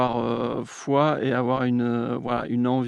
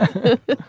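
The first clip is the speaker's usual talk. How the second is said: high burst of laughter; 11 semitones higher, 7 dB louder than usual. Real, not acted. Laugh after a joking attempt.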